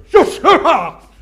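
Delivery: caller's news makes preacher whoop with joy; joyful laughing shout. Retold or imitated. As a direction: explode with glee down the phone. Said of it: "on the other end of the phone going".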